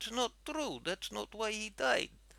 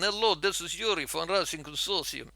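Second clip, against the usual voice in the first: louder official greeting in fake Russian accent; Yuri; 6 dB louder than usual. Answering the scammer's second call, official Russian accent. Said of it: "in this accent".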